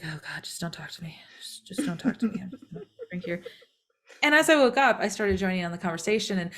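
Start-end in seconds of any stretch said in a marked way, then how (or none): 0.0-3.4 barely awake, breathe the words over your coffee; groggy whisper. Retold or imitated. Narrator before coffee, begging chatty Heather for silence, in a groggy whisper. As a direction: whisper it, too tired to talk, pleading to be left alone.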